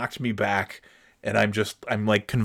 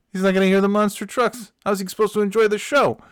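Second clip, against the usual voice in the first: scoffing rant; 9 semitones higher, 4 dB louder, faster than usual. Retold or imitated. Ranting about friend's earplugs.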